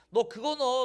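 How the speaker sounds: sudden loud stern warning; stern shout. Acted. God warning Jeremiah about the belt, a loud stern shout. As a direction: bark the order at him.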